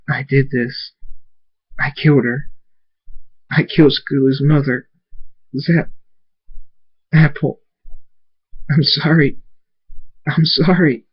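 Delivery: acted crying. A narrator's reading. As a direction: break the words up as if weeping. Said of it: "he continues to cry".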